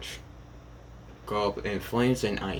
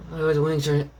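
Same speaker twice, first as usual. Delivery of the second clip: high, louder burst of admiration; admiring gush; 6 semitones higher, 5 dB louder than usual. Acted. Kirishima sees her wings and horns, gushing. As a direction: exclaim in delight, pitched up.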